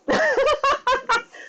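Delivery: high excited cackle; overexcited giggling; giddy laugh. Real, not acted. Giddy laugh after apologizing.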